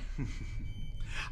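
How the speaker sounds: soft sinister chuckle. Acted. Villain laughs at children's promise.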